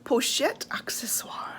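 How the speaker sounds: hushed reverent whisper of the bag name; soft dramatic whisper. Real, not acted. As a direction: breathe the name like a treasure. Breathy name announcement.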